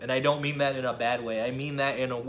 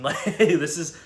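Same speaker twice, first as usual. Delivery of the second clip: laughing; 4 semitones higher, 7 dB louder, slower than usual. Real, not acted. Laughing while praising quarantine.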